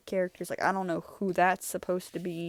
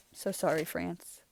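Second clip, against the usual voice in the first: ordinary talk, nothing marked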